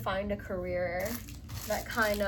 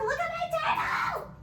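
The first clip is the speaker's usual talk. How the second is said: mock squeaky excitement; much faster than usual. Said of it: "And I'm just like".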